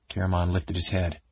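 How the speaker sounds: plain reading voice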